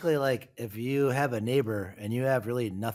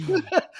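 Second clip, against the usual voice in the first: high burst of real laughter; laughs; 5 dB louder than usual. Real, not acted. Cracking up while retelling the story, high laugh. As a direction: laugh out loud at the memory.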